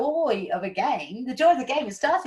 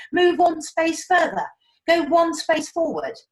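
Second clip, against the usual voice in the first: normal talking voice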